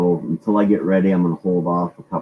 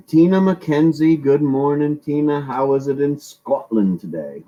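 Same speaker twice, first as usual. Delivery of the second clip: bad Scottish accent; 8 semitones higher than usual. Shown as a demonstration. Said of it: "I'm doing the accent absolutely wrong".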